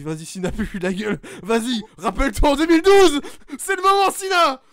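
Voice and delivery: shouted cheer, rising high and loud; encouragement de fan. Retold or imitated. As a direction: pousse Cena comme un gamin surexcité. Cheering Cena on like a child. (fan qui hurle pour Cena)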